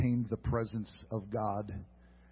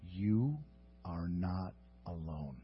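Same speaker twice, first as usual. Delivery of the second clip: tender reassurance; 7 semitones lower, 9 dB quieter than usual. Acted. Preacher comforting the lonely listener, soft and tender. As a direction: lay it gently on them.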